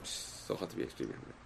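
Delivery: soft unvoiced murmur; hushed wonder to the class; whispered aside. Real, not acted. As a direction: breathe it out almost silently.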